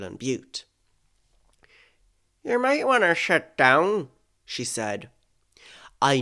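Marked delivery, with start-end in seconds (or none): 2.4-4.1 higher-pitched Northern Irish voice; attempted Northern Irish, pitched up; Northern Irish accent. Friend about to break bad news, Northern Irish accent. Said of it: "try to do this in a Northern Irish accent".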